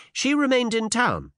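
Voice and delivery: put-on high, dignified young lady. A narrator's reading. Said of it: "said the minx with dignity".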